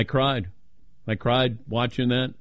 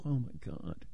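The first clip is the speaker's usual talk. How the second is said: muttered exasperation; 14 dB quieter than usual. Retold or imitated. Exasperated reply to wife's swooning.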